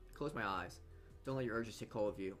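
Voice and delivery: normal talking voice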